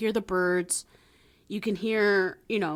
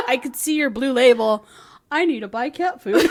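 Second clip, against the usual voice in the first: laughing through words; 4 semitones higher, 7 dB louder than usual. Pretend. Pretend NPC line while laughing.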